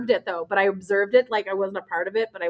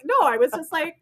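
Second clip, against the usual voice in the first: ordinary talk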